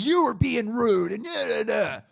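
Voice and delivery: high, loud drawn-out retold yell; mock screaming. Stranger screaming from his car window, imitated rant. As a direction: imitate a ranting heckler, trailing into nonsense. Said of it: "starts screaming at me from his window".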